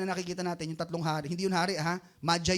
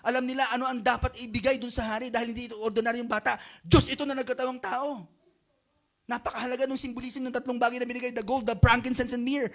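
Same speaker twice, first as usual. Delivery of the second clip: high, loud, fervent sermon; full-force preaching; 9 semitones higher, 7 dB louder than usual. Acted. Pastor exhorting the congregation, high and loud. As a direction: preach it out to the whole room.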